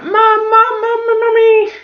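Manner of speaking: high, loud mimicked kid's call; imitated pestering child's yell; child shouting upstairs. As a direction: call insistently for mum like a small child. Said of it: "like, shouting up the stairs".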